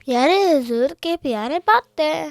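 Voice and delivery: fast, loud blurted title; eager blurt. Real, not acted. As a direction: rush the title out in one eager breath. Child jumps in announcing title.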